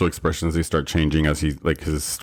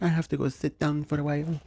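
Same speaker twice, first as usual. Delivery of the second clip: high, soft little voice; gentle imitation; 8 semitones higher, 6 dB quieter than usual. Co-host mimicking a gentle woman in the film, high and soft. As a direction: gently put on, high and quiet. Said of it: "She's so, like, gentle".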